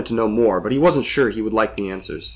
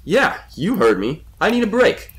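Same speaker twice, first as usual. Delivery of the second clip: slow, raised insistence; emphatic, higher-pitched retort; defiant; 10 semitones higher than usual. A narrator's reading. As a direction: stand your ground. Defiant reply to the demanding voice.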